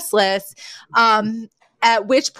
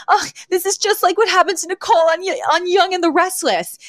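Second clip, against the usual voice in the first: high, fast Fran impression; lightly nasal-free mock Fran, rushed and high; imitating Fran; 10 semitones higher than usual. Fran to the police, rushed and high-pitched. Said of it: "Fran's like".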